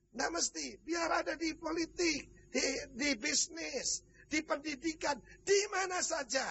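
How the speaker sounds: high, drawn-out phrases building upward; rally them item by item, stretching each phrase; rousing preaching. Real, not acted. Preacher telling believers they can serve anywhere, rousing preaching.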